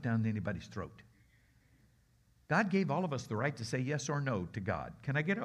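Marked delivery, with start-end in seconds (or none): none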